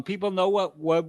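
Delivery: usual speaking voice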